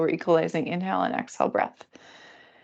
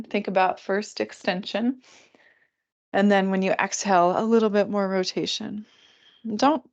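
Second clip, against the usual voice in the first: ordinary talk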